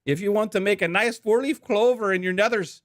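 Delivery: high-pitched, hammed-up Irish accent sponsor read. Podcast host reads the Saint Patrick's Day sponsor ad in a hammed-up Irish accent. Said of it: "we're gonna be reading this with an Irish accent".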